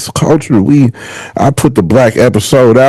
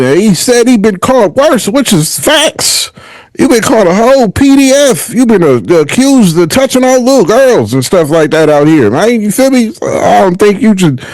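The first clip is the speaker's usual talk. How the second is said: incredulous mockery; 8 semitones higher than usual. Real, not acted. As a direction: pitch jumps way up in disbelief, stretching out "which is facts". Mocking [name] over his accusations.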